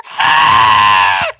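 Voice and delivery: loud fangirl scream; excited squeal. Retold or imitated. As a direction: shriek with joy. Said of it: "I squealed like a girl".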